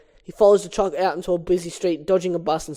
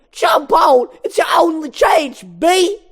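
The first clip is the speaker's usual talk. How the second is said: urgent high comedian shout; Chris Rock; 11 semitones higher, 9 dB louder, slower than usual. Acted. Moose Blood urges Barry onto the wiper, shouting. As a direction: yell the order, pitched way up. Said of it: "I'm gonna do the Chris Rock voice".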